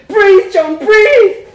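very loud, high panicked cry; panicked scream. A narrator's reading. Wife to choking husband, screaming in panic. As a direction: scream his name in terror.

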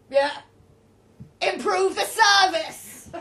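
slow, loud and laughing. Acted. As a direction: laugh through a cheeky joke. Roger jokes about the tip.

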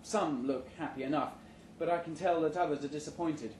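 ordinary delivery

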